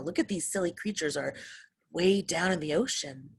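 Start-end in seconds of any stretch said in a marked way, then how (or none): none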